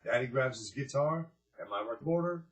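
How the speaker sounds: put-on picture book read to a room of children; story narration. A narrator's reading. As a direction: read it big and bright like storytime for kids. Reads picture book aloud.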